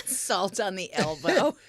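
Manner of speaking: slow echo through laughter; amused echo. Real, not acted. Co-host echoes the host's odd phrase, laughing. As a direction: repeat it slowly, laughing at the wording.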